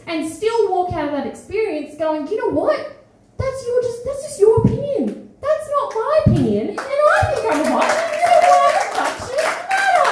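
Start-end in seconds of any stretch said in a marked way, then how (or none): 2.3-10.1 much higher and louder, fierce and proud; defiant shout. Pretend. Standing up to a line of insulting guys, defiant shout. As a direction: shout it back at them, unbowed. Imagined defiant reply to catcallers.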